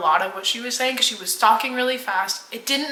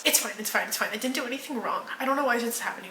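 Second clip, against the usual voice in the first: much softer, rattled off; mock glazed rambling; 10 dB quieter, much faster than usual. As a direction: imitate her dazed, murmured denial. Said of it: "Talking a mile a minute".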